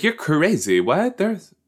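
high-pitched imitation of an incredulous girl; exaggerated high mimicry; mock disbelief. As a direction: squeal it as she would, incredulous. Acts out character's reaction.

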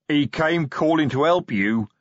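angry troll snarl; troll sergeant snarling at his boss. Acted. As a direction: bite the words out at Vimes. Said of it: "snarled Detritus".

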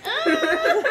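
very high, loud mock wail; imitated kid's cry. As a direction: wail like a scared child, laughing. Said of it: "Starts crying".

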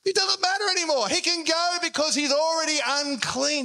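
high-pitched, insistent outcry; exclaiming crowd. Acted. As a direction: declare it triumphantly for the whole crowd. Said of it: "the whole crowd now is like".

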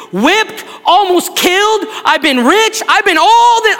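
building toward the altar call, fervent; preaching at full force. Acted.

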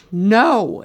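emphatic mock yell; retold scream. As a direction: imitate a mother screaming her answer. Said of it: "And she's screaming at me".

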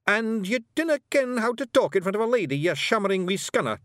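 high, loud Scots telling-off; scolding Scottish Feegle. A narrator's reading. Rob rebuking Daft Willie for talk before a lady, scolding.

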